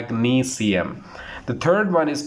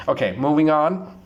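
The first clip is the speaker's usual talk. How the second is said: usual speaking voice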